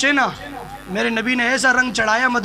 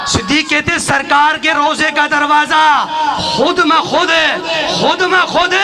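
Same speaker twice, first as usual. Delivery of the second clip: raised, ringing sermon delivery with drawn-out line endings; full-force preaching; 4 semitones higher, 4 dB louder than usual. Real, not acted. Preacher telling of the tomb door opening, fervent full force. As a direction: build to a fervent climax, stretching the final words.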